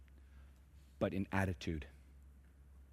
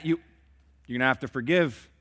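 normal talking voice

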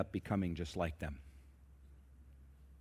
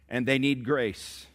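ordinary talk, nothing marked

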